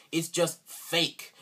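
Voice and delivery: ordinary talk, nothing marked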